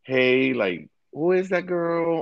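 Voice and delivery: imitating a girlfriend's questioning, high-pitched; mildly jealous. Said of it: "is a bit jealous and is like".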